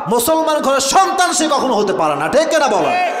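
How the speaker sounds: high-pitched, rousing sermon call; impassioned proclamation ending in a challenge; forceful preaching. Real, not acted. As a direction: press the congregation to agree. Continues proclamation, demands crowd agree.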